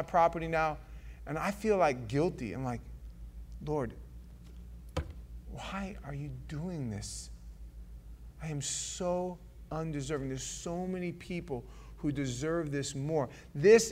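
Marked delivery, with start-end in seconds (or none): 3.7-10.3 humbled prayer. Retold or imitated. Pastor praying over his own house, soft and humbled. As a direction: say it quietly, ashamed of the blessing.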